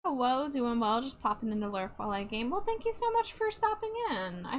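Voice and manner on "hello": normal talking voice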